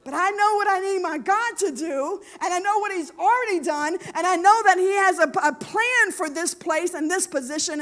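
high, loud, driving sermon voice; impassioned preaching. Real, not acted. Preacher telling the congregation about persistence, impassioned preaching. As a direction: preach it at full force.

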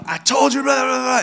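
high-pitched shouted telling-off; mock scolding. Dad telling off his son after the swat, mock scolding. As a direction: rattle off a hammed-up parental rant.

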